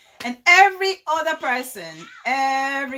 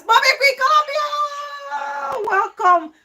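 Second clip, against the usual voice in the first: high, drawn-out welcome shout; excited call, stretched long on the name; shouted greeting; 14 semitones higher, 5 dB louder, slower than usual. Real, not acted. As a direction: hail an arriving friend at the top of your voice. Calls out a greeting to viewer.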